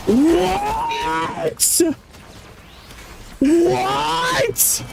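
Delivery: high, slow, stunned what; disbelieving, drawing out the words; shocked disbelief. Real, not acted. Reacting to the accent smear, in shocked disbelief.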